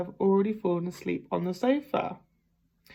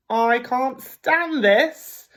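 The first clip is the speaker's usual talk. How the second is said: slow, louder, fed-up complaint; exasperated; 4 semitones higher, 5 dB louder, much slower than usual. Acted. Mr Bear can't sleep, slow exasperated complaint. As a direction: drag it out, fed up.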